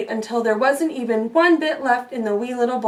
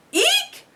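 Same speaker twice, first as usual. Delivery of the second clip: very high shrill eek; eek scream. Acted. Goldilocks wakes to bears, squealing eek. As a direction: shriek on waking. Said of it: "screamed Goldilocks".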